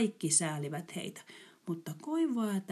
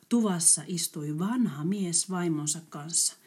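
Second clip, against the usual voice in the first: ordinary delivery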